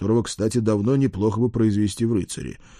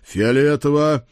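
slow, louder, higher brush-off; emphatic dismissal; 5 semitones higher, 5 dB louder than usual. Acted. Lord cuts off the page's protest, dismissively. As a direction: drag the word out dismissively.

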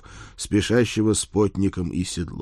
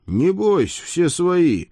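calling out brightly, higher; cheerful call; 10 semitones higher, 3 dB louder, slower than usual. Acted. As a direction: hail a friend cheerfully. Calling to the horse.

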